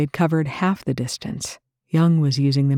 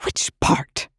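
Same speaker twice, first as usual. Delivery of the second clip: snarled. A narrator's reading. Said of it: "Rush snarled".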